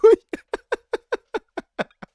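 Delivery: very high, loud, squealing laughter. Real, not acted. Streamer squealing with laughter at his own slides. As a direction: lose it completely in laughter.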